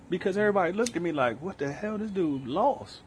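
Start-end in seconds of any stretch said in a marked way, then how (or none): 1.4-3.0 high, slow, baffled onlooker voice; mock puzzled crowd. Voicing the crowd staring at him, slow and baffled. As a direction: voice the stunned bar crowd, dragging it out.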